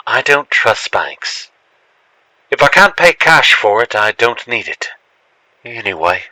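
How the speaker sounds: usual speaking voice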